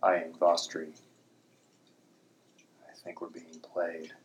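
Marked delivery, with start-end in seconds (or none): 2.9-4.1 hushed whisper. Acted. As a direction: keep it low so the captives can't hear. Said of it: "I kept my voice low".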